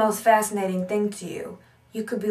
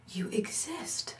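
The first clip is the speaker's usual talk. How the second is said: whispered thought; 10 dB quieter, slower than usual. Retold or imitated. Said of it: "the whole time I was thinking".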